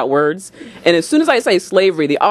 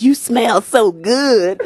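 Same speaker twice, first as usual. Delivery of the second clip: put-on slow, amazed drunk; 3 semitones higher, much slower than usual. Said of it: "he says".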